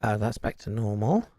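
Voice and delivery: normal talking voice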